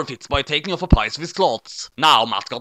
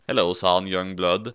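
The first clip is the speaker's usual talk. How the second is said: much lower, softer detective voice; lower calm L; 8 semitones lower, 4 dB quieter than usual. Acted. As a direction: answer the little god coolly and low. L answers the squeaky god.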